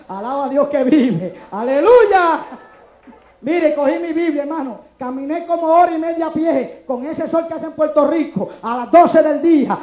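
loud, rising preacher's cry; full-force preaching. Acted. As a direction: drive it home to the congregation at full volume.

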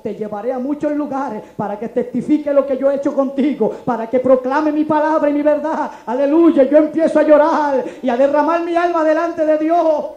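loud, rising preacher's cry; full-force preaching. Acted. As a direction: drive it home to the congregation at full volume. Preaching climax.

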